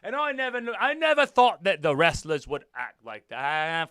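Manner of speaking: put-on, halting British accent. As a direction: keep up an amateur British accent. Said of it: "that is a British accent".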